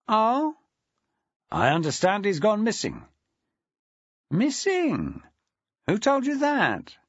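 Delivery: put-on a deputy head greeting an old mate; Aaron Felton, jovial. A narrator's reading. Aaron Felton greeting Maxwell on the phone, jovial.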